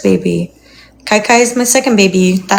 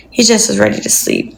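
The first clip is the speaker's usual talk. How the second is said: ordinary delivery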